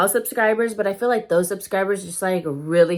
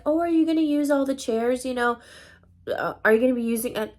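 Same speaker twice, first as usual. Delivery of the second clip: higher-pitched polite imitation; politely asking; 6 semitones higher than usual. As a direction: mimic a friend's harmless, nice question. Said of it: "she asked so nice".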